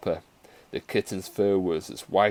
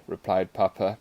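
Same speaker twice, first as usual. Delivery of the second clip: ordinary delivery, nothing marked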